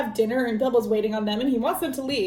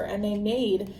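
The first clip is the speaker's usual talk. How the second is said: ordinary delivery, nothing marked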